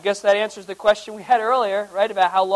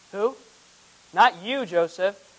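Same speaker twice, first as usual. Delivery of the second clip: usual speaking voice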